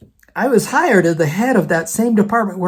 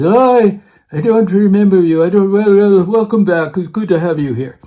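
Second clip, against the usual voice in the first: imitated Russian professor, starting loud and easing off; Russian accent; 2 semitones higher, 5 dB louder than usual. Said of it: "in his Russian accent".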